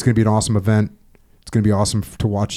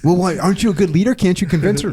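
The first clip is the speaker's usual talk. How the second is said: higher mock voice of nagging fans; pestering people; 7 semitones higher than usual. Listeners pestering him about his wife, mock high voice. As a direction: voice the pushy listeners, pitched up.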